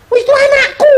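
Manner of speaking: squeaky put-on voice of a parent who won't let go; possessive mother. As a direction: ham up a clingy parent claiming her child. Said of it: "Kamu bilang apa?".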